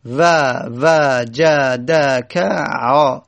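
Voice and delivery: و وجدک ع drawn out letter by letter, each fatha held; very slow spelling out. Shown as a demonstration. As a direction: stretch و وجدک ع to count the vowels. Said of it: "یعنی واو فتحه".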